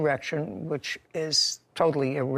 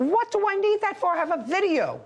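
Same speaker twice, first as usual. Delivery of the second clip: exasperated; 13 semitones higher than usual. Real, not acted. Judge exasperated at interruption.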